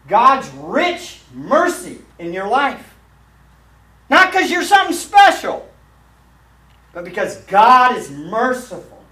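high, loud pulpit proclamation; fiery preaching. Acted. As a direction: drive the judgment home to the congregation. Preacher at full force.

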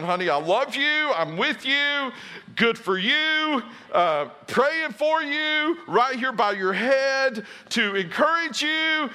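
high, slow, syrupy encouragement; doting husband. Retold or imitated. Husband to wife in labor, a high slow doting coo.

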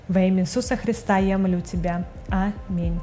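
ordinary talk

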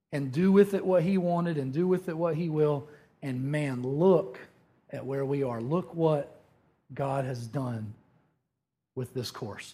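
ordinary delivery

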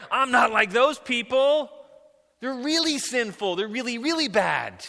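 high, loud, mock-indignant comparison; self-righteous protest. Sinner comparing himself with other people, self-righteously indignant. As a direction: protest loudly, stressing how bad others are.

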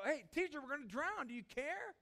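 high, loud frantic cry for help; panicked cry. Acted. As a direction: cry out to the sleeping teacher in panic. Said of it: "So they go".

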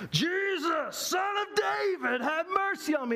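high, slow, desperate cry across the road; blind man yelling. Retold or imitated. Blind beggar yelling to Jesus, high pleading cry. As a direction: call out to someone you cannot see. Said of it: "starts yelling".

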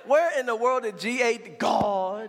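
drawn-out, solemn church prayer parody; mock Baptist prayer. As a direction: imitate a churchy Baptist praying, stretching the last word. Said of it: "the only way you know how to pray is Baptist".

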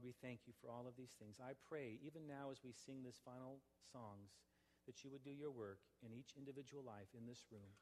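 soft, reverent prayer voice; closing prayer. Acted. Pastor closing the sermon in prayer, soft and reverent. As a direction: pray quietly over the congregation.

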